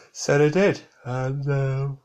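talking through a yawn; words stretched by a yawn. Real, not acted. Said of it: "excuse me, I yawned".

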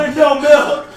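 shouting. Real, not acted. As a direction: yell the bad news. Repeats the news louder.

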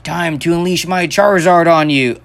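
hammed-up anime hero battle cry; overacted anime dub. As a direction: shout it like a cartoon trainer. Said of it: "sounded like an anime character".

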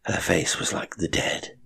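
usual speaking voice

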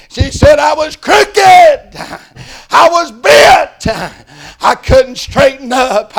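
high, loud preaching cries; full-force jubilant preaching; shouted testimony. Acted. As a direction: shout the healing to the church. Woman's testimony turns to preaching.

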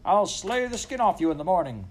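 high, loud threat; raging farmer. A narrator's reading. Farmer threatening his noisy dog, in a rage. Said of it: "in the midst of his rage".

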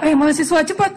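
high-pitched hurry-up call; imitated urgent call; mock calling out. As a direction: call students to hurry, voice jumping up. Imitating a call to running students.

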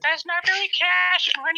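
doing Carl for friends, high put-on voice. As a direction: go way up into the character's voice. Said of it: "do the Carl impression".

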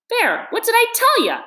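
high, loud triumphant crow. A narrator's reading. Rat showing the mole the doormat, triumphant crow. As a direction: gloat at being proved right. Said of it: "exclaimed the rat in great triumph".

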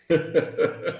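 risinho real depois da história; risada curta. Real, not acted. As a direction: ria brevemente da lembrança. Ri da própria história. (risada espontânea e curta)

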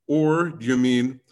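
ordinary delivery

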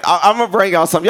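ordinary talk, nothing marked

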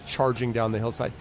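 ordinary delivery

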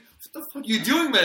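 loud, high retold outburst of disbelief; incredulous shout. Neighbor story, yelling at the man with the ladder, incredulous shout.

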